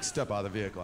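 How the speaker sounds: a hint of a cop's low order; clipped cop command, mildly acted; lightly voiced stern cop.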